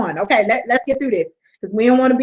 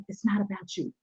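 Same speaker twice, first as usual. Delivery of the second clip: sweet voice; 4 semitones lower than usual. Retold or imitated. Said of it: "I could just hear his sweet voice say".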